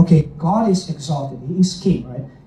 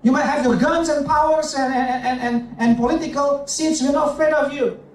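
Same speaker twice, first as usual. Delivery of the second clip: higher, bold and defiant, speaking for the cross; 7 semitones higher than usual. Acted. Preacher voicing the cross to tyrants, higher and defiant. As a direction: dare the tyrants, unafraid. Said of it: "the cross is saying".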